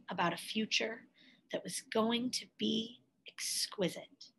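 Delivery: whispered softly, full of wonder; hushed whisper. A narrator's reading. Narrator to children, whispering of Gwendolyn's dream. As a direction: breathe it like a secret dream.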